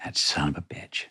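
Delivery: whispered, bitter curse under the breath; muttered curse. Retold or imitated. Jilted beach boss, alone on the beach, whispered curse. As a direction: hiss it to yourself, stood up. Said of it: "I have this image of her going".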